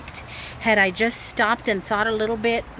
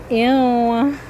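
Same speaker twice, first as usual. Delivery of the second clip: disgusted ew; 3 semitones higher, 7 dB louder than usual. Real, not acted. Disgust at melted cheese.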